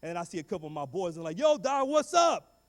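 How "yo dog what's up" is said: high, loud buddy calling out; homeboy greeting. Retold or imitated. Boys spotting him on the walk home, loud greeting. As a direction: hail him like a street friend. Friends greet crying boy.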